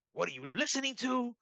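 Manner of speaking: high-pitched mock disbelief; imitating incredulous wife. Retells wife's incredulous reaction.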